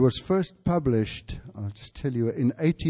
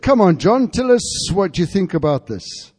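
raised voice; 4 semitones higher, 6 dB louder than usual. Retold or imitated. Preacher voices the men challenging John.

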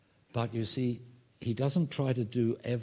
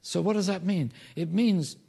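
raised, louder pulpit voice; emphatic preaching; 8 semitones higher, 6 dB louder than usual. Real, not acted. Preacher explaining what faith means to the congregation, loud and emphatic. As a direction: drive the point home to the congregation. Preaches application at full force.

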